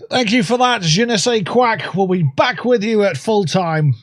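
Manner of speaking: lightly voiced Canadian host thanking the reporter; mild Canadian newsreader voice; Canadian accent. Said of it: "best Canadian accent I could do".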